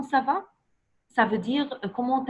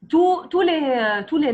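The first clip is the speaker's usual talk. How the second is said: tous, tous les said higher and louder, insisting; emphatic correction; 4 semitones higher, 4 dB louder than usual. Shown as a demonstration. Teacher insisting on tous to a pupil, loud and high. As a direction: insist firmly on the word tous. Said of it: "tu dis".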